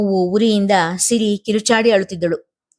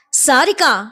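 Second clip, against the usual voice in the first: high sharp call of the name; wife crying out at the in-law, pitched up; cried out; 6 semitones higher, slower than usual. A narrator's reading. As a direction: snap her name out to stop her. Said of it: "ಚೀರಿದಳು Swati".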